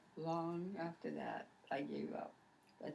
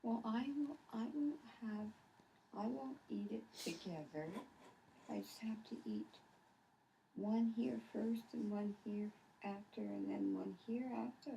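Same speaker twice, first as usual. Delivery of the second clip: imitating the fussy child; 6 semitones higher, slower than usual. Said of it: "And you'd say".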